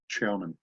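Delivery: "German" said as "Chairman"; German-accented "German". Shown as a demonstration. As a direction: open the vowel and harden the start: "Chairman". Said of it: "the word German is Chairman".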